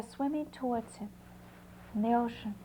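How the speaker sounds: ordinary delivery, nothing marked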